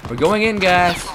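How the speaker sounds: high, loud call to the squad; rallying call. Real, not acted. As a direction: call out loudly to your teammates, pitched high.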